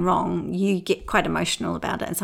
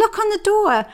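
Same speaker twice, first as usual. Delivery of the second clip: put-on high, loud excited child voice; child calling; 13 semitones higher, 6 dB louder than usual. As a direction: point it out eagerly, like a little girl. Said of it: "And she said".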